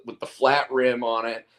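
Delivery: ordinary talk, nothing marked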